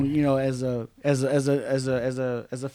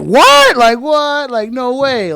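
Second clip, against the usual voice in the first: loud high what, then settling down; stunned fan hearing the news; shocked; 8 semitones higher, 9 dB louder, much slower than usual. Retold or imitated. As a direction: gasp it out in disbelief. People's reaction to sequel.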